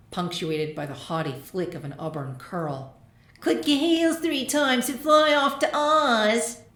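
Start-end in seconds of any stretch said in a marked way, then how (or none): 3.4-6.6 high, loud snide bully taunt. A narrator's reading. A bigger bully taunting young Sunset, snide and loud. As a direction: sneer down at the little filly.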